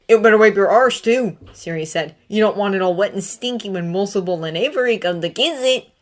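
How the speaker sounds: loud, high sneering jeer; mocking taunt. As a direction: rub it in with a sneer. Sirius taunting loudly.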